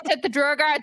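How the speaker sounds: ordinary delivery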